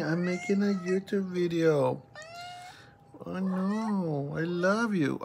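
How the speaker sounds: high, drawn-out cooing. Real, not acted. Owner greeting her cat mid-video, cooing high and slow. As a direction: coo lovingly up high to a pet.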